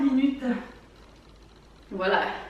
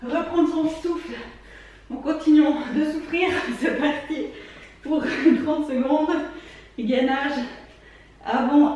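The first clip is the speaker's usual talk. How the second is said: workout coach; 2 semitones higher than usual. Acted. Coach counting through workout.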